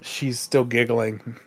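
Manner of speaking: usual speaking voice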